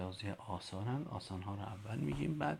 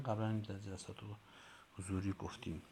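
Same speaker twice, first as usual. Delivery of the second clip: usual speaking voice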